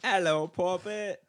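loud, slow mock-British greeting; hammy British hello; British accent. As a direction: drawl it in a put-on British accent. Joins the British accent bit.

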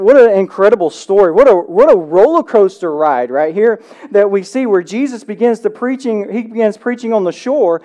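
ordinary talk

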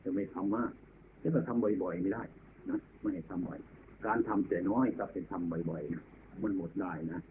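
ordinary delivery

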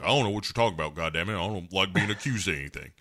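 a hint of a grumpy uncle, dropping soft at the end; mock grumble, trailing off quiet; gruff uncle voice. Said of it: "He's like".

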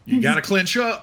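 high-pitched cartoonish coaching call; character catchphrase call. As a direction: call it out high, rising at the end. Player finishes the quote the character hears.

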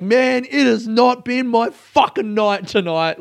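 high, slow, emphatic complaint; half-joking outburst at bad luck; exasperated snap. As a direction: drag it out in weary frustration. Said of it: "I just snapped".

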